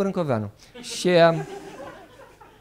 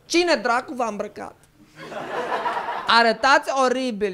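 fussy Radu the Handsome; 8 semitones higher than usual. Acted. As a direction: play a fussy dandy scolding his soldiers.

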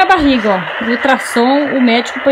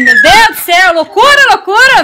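loud, high exclamation; real exasperated shouting; exasperated outburst; 6 semitones higher, 6 dB louder than usual. Real, not acted. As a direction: cry out at the chaos, loud and high. Exasperated at wild dogs and kids.

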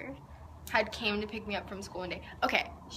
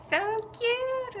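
gushing; 5 dB louder than usual. Real, not acted. Gushing to her girlfriend about the gift. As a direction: pitch leaps up on so cute.

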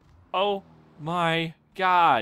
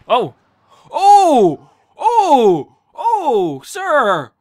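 sudden high loud cries of shock; startled yelps; 5 semitones higher, 10 dB louder, much slower than usual. Real, not acted. Gamer yelping when a prisoner attacks him. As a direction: yelp as you're hit out of nowhere.

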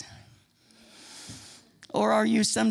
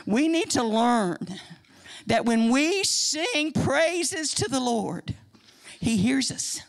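ordinary talk

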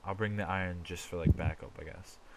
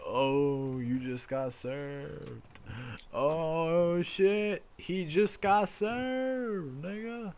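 mocking taunt; 9 semitones higher, 4 dB louder, much slower than usual. Taunting a friend after a comeback.

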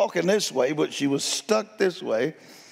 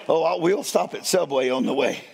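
normal talking voice

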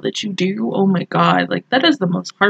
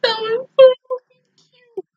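very high, gushing squeak; high emotional squeal; 14 semitones higher than usual. Real, not acted. Fan reacting to a cute episode, high squeal. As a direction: squeal it, overcome by cuteness.

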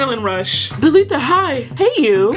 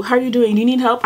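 ordinary delivery

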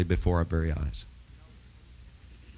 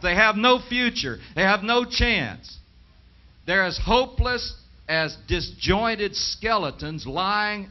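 higher, slower, mock-mournful preacher voice; mock lament of doom preachers, pitched up and slow; mocking imitation; 7 semitones higher than usual. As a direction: parody the gloomy dominionist preachers. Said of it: "sounds just like most of these dominionist messages".

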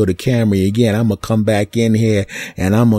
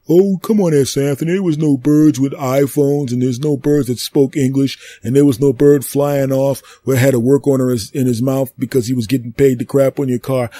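higher, scoffing doubter's voice; skeptical listener; 5 semitones higher than usual. Pretend. Imagined listener dismissing Anthony's story, scoffing higher.

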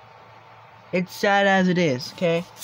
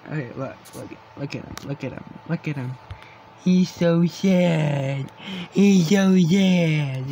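mock mournful. Mock-mourning over sad cat.

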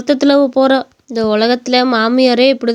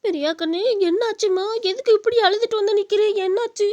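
much softer, higher, tender coaxing; soft soothing; 5 semitones higher, 8 dB quieter than usual. Acted. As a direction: hush and coax the crying child. Mother soothes her crying daughter. (அழும் மகளை மென்மையாகத் தேற்றுதல்)

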